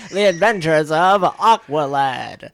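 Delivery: slow mock announcer voice for a cartoon title. Joking about Aqualad, mock show announcer. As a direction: boom it out like an old cartoon intro.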